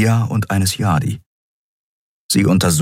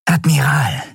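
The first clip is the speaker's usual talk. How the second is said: Shia crying out to the admiral, higher; alarmed cry of his rank; urgent call; 8 semitones higher than usual. A narrator's reading. Shia to the wounded admiral, an urgent call.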